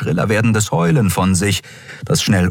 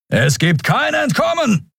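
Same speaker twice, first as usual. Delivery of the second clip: high-pitched menacing yell; thundering shout. A narrator's reading. Scarred man to trapped enemies, thundering shout. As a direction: thunder it down the corridor. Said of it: "donnerte ein narbengesichtiger Mensch".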